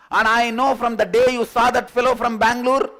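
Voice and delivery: loud, high, with laughter; mock scold, half laughing; scolding girlfriend. Girlfriend accuses him about the Bangalore fellow, loud, laughing.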